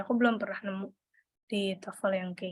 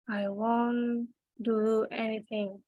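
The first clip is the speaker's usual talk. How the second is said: slow model sentence; much slower than usual. Shown as a demonstration. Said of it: "Jadi kelihatan jelas ya".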